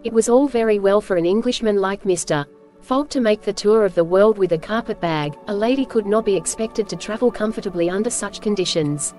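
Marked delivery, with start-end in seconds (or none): none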